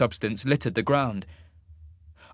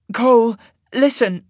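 high, loud appeal; urgent calling out; 13 semitones higher, 10 dB louder than usual. A narrator's reading. Sasha trying to stop Cole storming off, calling out urgently. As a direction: cut in loudly, pitched up, to make him listen.

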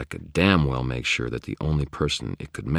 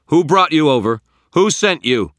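high, loud demand; shouted interrogation; 13 semitones higher, 12 dB louder than usual. A narrator's reading. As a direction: demand answers at full voice. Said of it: "I shouted at it".

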